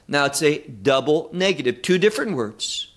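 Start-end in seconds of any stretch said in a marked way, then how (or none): none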